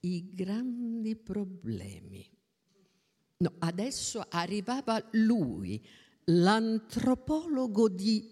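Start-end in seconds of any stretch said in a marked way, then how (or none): 0.0-2.3 slow sarcastic whisper; a hushed, ironic slow whisper; whispered aside. Acted. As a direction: breathe it out as a mocking aside.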